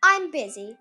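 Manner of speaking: a hint of a storybook cat; lightly voiced purring cat. A narrator's reading. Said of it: "purred the cat".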